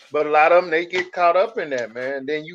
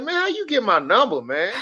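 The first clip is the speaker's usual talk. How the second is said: annoyed; 7 semitones higher than usual. Retold or imitated. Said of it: "I be like".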